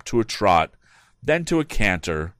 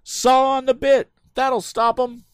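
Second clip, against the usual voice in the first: shouting; 5 dB louder, slower than usual. A narrator's reading. Tom shouting instructions.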